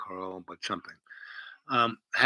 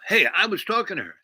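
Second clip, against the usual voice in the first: mildly raised, quick objection; mock indignant protest; 7 semitones higher, 4 dB louder, faster than usual. Rival interrupts the guy talking to her, mock indignant. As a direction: object in a higher, hurried voice.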